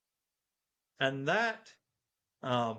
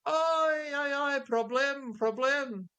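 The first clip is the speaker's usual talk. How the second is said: taxi driver; 11 semitones higher, slower than usual. Retold or imitated. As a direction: yelp it out like a panicked cabbie. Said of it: "the taxi driver was like".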